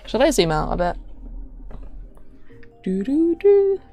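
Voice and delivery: ordinary talk, nothing marked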